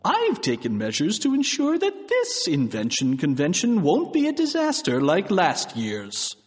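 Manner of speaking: principal's emphatic announcement; Mister Krupp. A narrator's reading. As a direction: make a speech to the assembly. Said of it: "standing up at the microphone".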